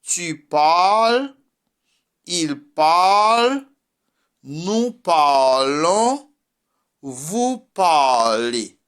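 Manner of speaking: tu parles, il parle, nous parlons, vous parlez said slowly and carefully; slow, careful French teacher modelling; French conjugation demo. Shown as a demonstration. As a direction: model each French form slowly for the class. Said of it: "voy a conjugar ese".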